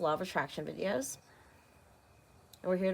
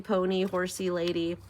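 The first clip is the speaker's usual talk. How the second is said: ordinary talk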